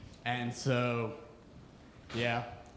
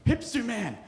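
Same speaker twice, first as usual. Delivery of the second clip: panicked mother; 8 semitones higher, 6 dB louder than usual. Retold or imitated. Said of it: "a mother would be like".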